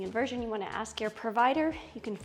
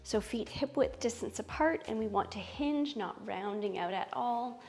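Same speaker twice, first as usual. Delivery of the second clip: soothing yoga teacher; 5 dB quieter, slower than usual. Acted. Guides yoga poses slowly.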